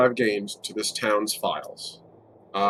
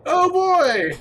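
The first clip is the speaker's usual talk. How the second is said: excited dread; 5 dB louder than usual. Real, not acted. Dreading a stealth roll.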